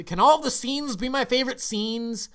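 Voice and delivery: high, loud fangirl-style enthusiasm; gushing plea. Real, not acted. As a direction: plead playfully, voice soaring with excitement. Gushing, unable to pick a favorite.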